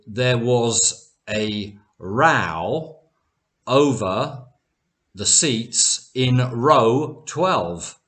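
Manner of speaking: very slowly, first "row" like "cow", second "row" like "go"; both rows contrasted. Shown as a demonstration. Teacher gives learners an example sentence, slow, "row" as rau then roe. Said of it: "both of these words are nouns".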